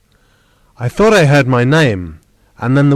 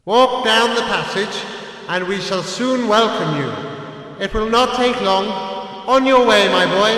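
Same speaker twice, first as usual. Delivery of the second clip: high uncle's voice, softer on the promise; encouraging uncle; 8 semitones higher, 3 dB quieter than usual. A narrator's reading. Uncle urges Axel down the passage, high and encouraging. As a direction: urge the boy onward.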